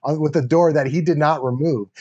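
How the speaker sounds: ordinary delivery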